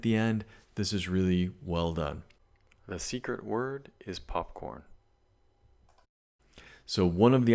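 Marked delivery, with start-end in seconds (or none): 2.9-4.8 much quieter, confidential delivery; secret aside. Real, not acted. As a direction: slip it in softly, like a secret. Slips in a secret word.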